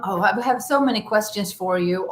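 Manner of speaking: ordinary talk, nothing marked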